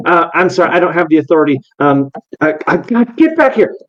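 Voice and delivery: a harried helper chasing a runaway Pokemon; frantic servant. Acted. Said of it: "the first one you approach is like".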